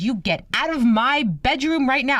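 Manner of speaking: high, emphatic retold command; indignant order. Grandmother to the man in her room, indignant. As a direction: order the intruder out in mock outrage.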